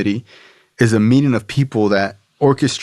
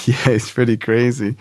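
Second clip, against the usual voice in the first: laughing; 2 semitones higher than usual. Real, not acted. Laughing reply about sponsor.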